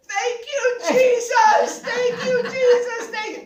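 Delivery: high, loud shouted thanks to Jesus; retold jubilant yell of thanks; yelling praise. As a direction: shout it out in joy. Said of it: "I'm yelling".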